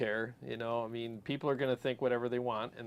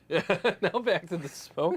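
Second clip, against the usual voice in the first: higher, laughing as he echoes; teasing laugh; 6 semitones higher, 4 dB louder than usual. Retold or imitated. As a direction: tease her through laughter.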